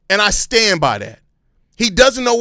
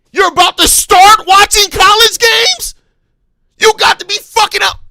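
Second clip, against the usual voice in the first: much higher and louder, screaming in disbelief; furious shout; 10 semitones higher, 6 dB louder than usual. Real, not acted. Fan screaming at the manager over college games, outraged yell. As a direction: blow up at him. Repeats the question, exploding.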